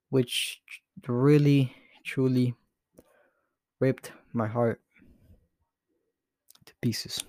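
real grief, halting between words; fading almost to a whisper on the last word; choked up. Real, not acted. Podcaster sharing news of his teacher's death, choked up. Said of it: "I'm getting emotional".

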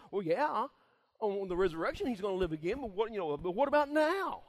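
preacher voices Martha pressing Jesus; Martha pushing back. Retold or imitated. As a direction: press him on the now.

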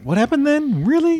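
high, baffled "What happened then? Really?"; mock bewilderment, voice shooting up; confused surprise. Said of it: "and sometimes we're like".

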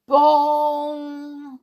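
loud, high ringing bong; clock bell chime. A narrator's reading. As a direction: imitate Big Ben, letting the note ring out. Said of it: "Ben began to chime".

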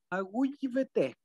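Aigüeyvete drawn out slowly, each syllable clear; slow Guaraní thanks. Shown as a demonstration. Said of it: "Ahí te estoy dando muchas gracias".

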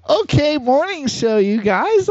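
drawn-out announcer hype; hyped show intro. Acted. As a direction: announce it like a host.

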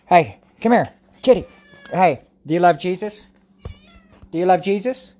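high coaxing cat call. Real, not acted. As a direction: sweet-talk the kitty. Calling the cat again.

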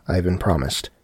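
plain reading voice

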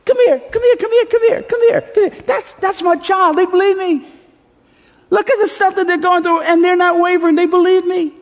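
very high-pitched gushing father; God, beaming proud. Acted. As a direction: show off your child in an excited, high voice. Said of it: "says with a huge smile on his face".

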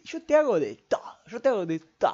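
hammed-up showman voice; mock showman boast. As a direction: brag like a stage mentalist. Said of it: "ahora soy mentalista".

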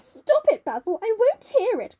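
high, loud theatrical protest; dramatic cry. A narrator's reading. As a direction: burst out, cutting him off in outrage. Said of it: "cried Dorian, leaping to his feet".

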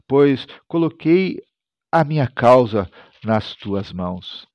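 normal talking voice

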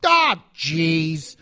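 a worker owning up to a mistake; factory worker. Acted. Co-host showing how someone talks at the factory in nineteen fifty, factory worker. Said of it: "something somebody says when they mess up".

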